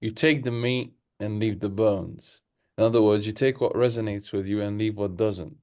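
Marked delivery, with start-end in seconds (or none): none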